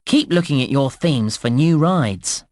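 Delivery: ordinary talk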